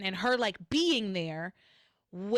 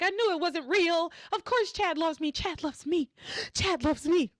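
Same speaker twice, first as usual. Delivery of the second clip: excited crying; 7 semitones higher than usual. Retold or imitated. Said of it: "She's excited. She's crying".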